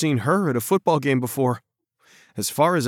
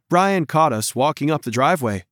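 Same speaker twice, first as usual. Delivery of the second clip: ordinary delivery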